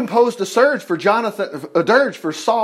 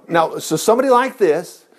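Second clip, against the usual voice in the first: usual speaking voice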